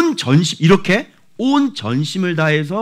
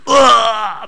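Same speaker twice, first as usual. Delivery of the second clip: mock cry; 9 semitones higher, 6 dB louder, much slower than usual. Acted cry of hurt listener.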